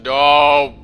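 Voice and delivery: loud, sharp shouted no. Real, not acted. A startled gamer shouting no at the screen.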